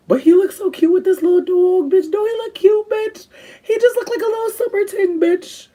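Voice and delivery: squealing high and loud over a cute photo; gushing. Real, not acted. Gushing over his photo, high and loud. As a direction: gush like you can't contain it.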